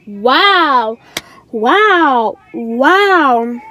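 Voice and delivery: long drawn-out, swooping wows; exaggerated sarcastic disbelief, retold; disbelieving wow. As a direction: stretch each word in mock amazement.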